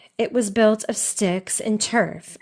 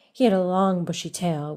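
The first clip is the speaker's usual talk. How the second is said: plain reading voice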